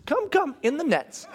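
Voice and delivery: high-pitched coaxing call; calling the fishies. Retold or imitated. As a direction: call little creatures in with a light high voice. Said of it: "as though someone called all the fishies".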